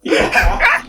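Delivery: breathy falsetto yeah; squeaky high yeah. Real, not acted.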